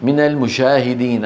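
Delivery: المشاهدين with sh where j belongs; jim mixed with shin. Retold or imitated. Teacher imitates the misread verse, jim as shin. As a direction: soften the j fully into sh in المشاهدين. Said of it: "خلط صوتها بالشين".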